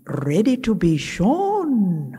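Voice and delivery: long, lilting Scottish drawl on "shown"; Scottish accent. Retold or imitated. Friend retelling the Lord's answer, Scottish accent. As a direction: imitate her Scottish voice, stretching the last word high. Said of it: "in her wonderful Scottish accent".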